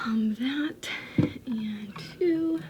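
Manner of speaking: soft unvoiced whisper. Real, not acted. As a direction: whisper it to yourself. Whispered counting items.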